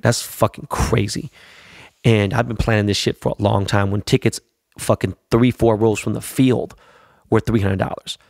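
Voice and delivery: usual speaking voice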